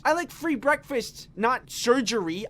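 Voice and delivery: high, loud exasperated rant; sarcastic outrage, slowing on "not surgery"; mock outrage; indignant retort.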